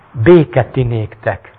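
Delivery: very slow, grave blessing; Jesus blessing the crowd a third time; slow solemn blessing. Acted. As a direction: draw each word out. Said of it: "mondta harmadszor is".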